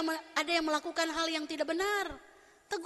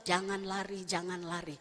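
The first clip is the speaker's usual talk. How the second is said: low, soft muttering to herself; self-reminder mutter; 9 semitones lower, 6 dB quieter than usual. Retold or imitated. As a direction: quietly remind yourself not to rush. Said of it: "saya selalu". (gumaman pelan mengingatkan diri)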